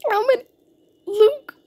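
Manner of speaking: high-pitched excited outburst; thrilled. Real, not acted. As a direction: squeal it, overjoyed at a rare win. Thrilled at getting rare pet.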